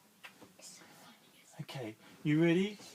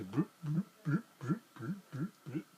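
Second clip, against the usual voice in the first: unvoiced bloop bloop travelling noise; whispered bloop. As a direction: whisper the bloops as it hops along. Starfish travelling sound.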